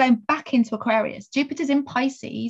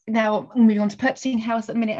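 normal talking voice

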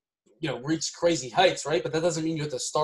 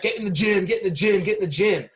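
higher, urgent chant-like repetition; raised-voice mock badgering; mock yelling; 5 semitones higher, 4 dB louder than usual. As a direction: imitate someone yelling orders, punching each repeat. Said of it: "yelled at".

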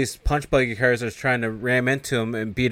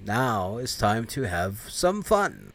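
softer, very slow villain voice; sinister glee; 5 dB quieter, much slower than usual. Retold or imitated. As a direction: savor each word wickedly. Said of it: "he's like".

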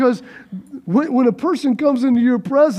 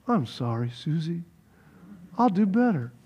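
much lower, much softer apology; meek soft reply; 7 semitones lower, 9 dB quieter than usual. Acted. Preacher voicing the husband apologizing to his wife, very soft and low. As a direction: answer her gently and low. Husband answering softly in sketch.

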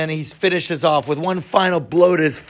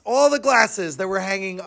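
usual speaking voice

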